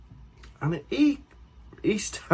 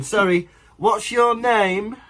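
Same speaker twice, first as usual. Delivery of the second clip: nosy stranger mock; 6 semitones higher, slower than usual. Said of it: "some stranger's going".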